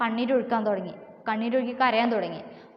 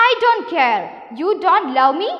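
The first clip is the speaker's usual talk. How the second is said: loud, raised-pitch storybook complaint; acted protest; 5 semitones higher, 7 dB louder, much slower than usual. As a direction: play the wife's defiant reproach, lightly acted. Wife quarrels with crocodile. (ഭാര്യയുടെ പരിഭവം ഉറക്കെ അഭിനയിച്ച്)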